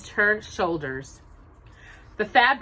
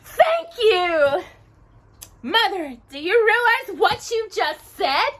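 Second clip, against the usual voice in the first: much higher, louder whine; complaining; 13 semitones higher, 6 dB louder, slower than usual. Acted. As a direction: whine at mother in disbelief. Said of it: "Drusilla complained".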